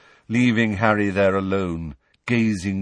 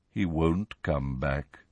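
softer, slow sorrowful voice; mournful; 6 semitones lower, 5 dB quieter, slower than usual. Acted. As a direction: say it with heavy sorrow. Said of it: "looked mournfully at Harry".

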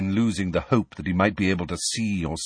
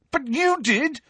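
angrily; slower than usual. Acted. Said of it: "said Harry angrily".

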